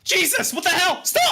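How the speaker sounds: high, panicked protest; acting erratic. Streamer fends off an unseen attacker, high frantic shout. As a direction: shout it out, pitch leaping up. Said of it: "you're acting erratic".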